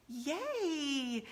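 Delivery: high, long-drawn little cheer; mock muted excitement; imitating husband's understated yay. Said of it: "means my equivalent".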